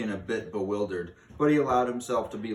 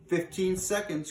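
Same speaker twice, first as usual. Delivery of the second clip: witch crying out; 9 semitones higher than usual. A narrator's reading. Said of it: "cried the Grand High Witch".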